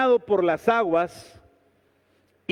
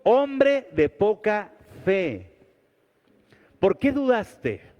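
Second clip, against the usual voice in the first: slow rebuke; slower than usual. Acted. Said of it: "y le dijo".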